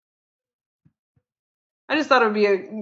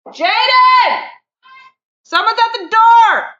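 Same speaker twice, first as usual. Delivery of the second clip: high, very loud shout through the house; real yell; 12 dB louder, slower than usual. Real, not acted. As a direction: call out to someone in another room. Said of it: "Sorry, I yelled".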